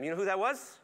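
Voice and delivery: ordinary delivery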